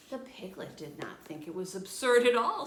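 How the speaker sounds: ordinary delivery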